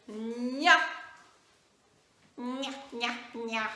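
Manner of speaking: teacher sounding out "gna"; syllable drill. Shown as a demonstration. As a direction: sound out gna slowly and clearly. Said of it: "Donc, on y va?".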